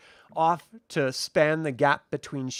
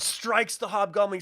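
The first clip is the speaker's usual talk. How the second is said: usual speaking voice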